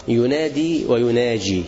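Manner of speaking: ينادي ويناجي said slowly, the د and the ج set apart; slow teacher's contrast; contrast of two words. Shown as a demonstration. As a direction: lean on د in ينادي and ج in يناجي. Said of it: "ينادي بالدال ويناجي بالجيم".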